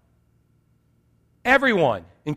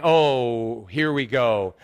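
resigned dread; 7 semitones lower, much slower than usual. Retold or imitated. Dreading being found out.

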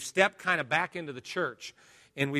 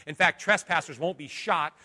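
usual speaking voice

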